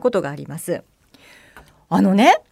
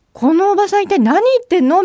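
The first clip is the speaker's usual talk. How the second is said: incredulous; 10 semitones higher, 5 dB louder, faster than usual. Retold or imitated. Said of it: "みたいな目で".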